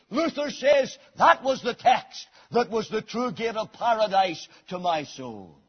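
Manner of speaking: high, loud pulpit preaching building to a shout; full-force preaching. Acted. Preacher rousing his congregation, at full force. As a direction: preach it hard to the back pews.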